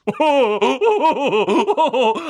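high, loud poltergeist laughter; gleeful cackle. A narrator's reading. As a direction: cackle in mocking triumph. Peeves laughs at tricking Filch.

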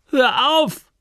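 put-on high, loud girl's cry to stop; girl calling out. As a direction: call out high and sharp as the girl. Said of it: "rief sie".

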